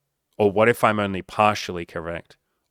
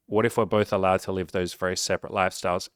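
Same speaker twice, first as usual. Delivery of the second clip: ordinary delivery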